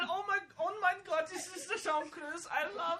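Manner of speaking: high, laughing fangirl squeal; squealing fan voice. Acted. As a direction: gush like a starstruck fan.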